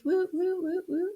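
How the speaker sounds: high, soft little woos; celebratory woo. Real, not acted. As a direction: cheer lightly with high whoops. Praising students as rock stars.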